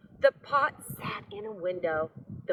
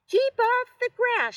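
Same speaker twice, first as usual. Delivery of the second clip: very high, loud shout; 10 dB louder than usual. A narrator's reading. Hank shouting at the passing cowboy, high. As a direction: shout him off the lawn. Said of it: "shouted Hank".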